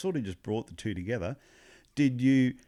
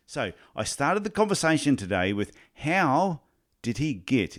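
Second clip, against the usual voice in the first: loud, punchy host introduction with emphatic swings in pitch; podcast intro read; 5 dB louder than usual. Real, not acted. Host introducing today's coaching guest to listeners, punchy intro read. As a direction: sell the episode with big emphasis.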